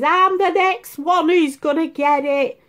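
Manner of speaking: high, loud mock doom; hammed-up dread of catching it; imitating Ali. Daughter fearing the bug, high hammed-up dread. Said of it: "Ali says".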